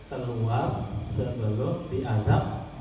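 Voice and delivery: low, drawn-out command; slow, measured order to get out; slow stern warning. Shown as a demonstration. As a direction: stretch each word of the threat. Repeats warning as last weapon.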